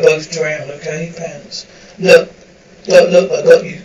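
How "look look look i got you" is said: loud, forceful reassurance; urgent shout. Acted. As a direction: shout to calm a panicking man. Rescuer shouting over water.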